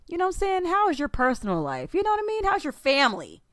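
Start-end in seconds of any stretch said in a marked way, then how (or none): 0.1-0.6 squeaky high-pitched tag question; high squeaky aside. Pretend. 1.9-2.4 high squeaky aside. Pretend. High squeaky tag question.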